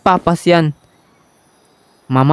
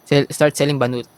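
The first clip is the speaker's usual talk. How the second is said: usual speaking voice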